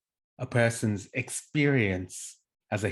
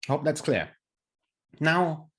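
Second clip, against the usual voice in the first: ordinary talk, nothing marked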